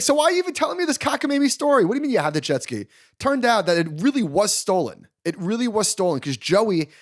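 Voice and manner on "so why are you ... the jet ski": higher and fast, incredulous; exasperated disbelief. Retold or imitated. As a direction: fire back fast at an absurd excuse. Exasperated at the driver's story.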